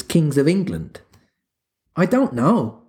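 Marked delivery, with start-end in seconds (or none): 2.0-2.8 uneasy. A narrator's reading. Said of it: "he replied uneasily".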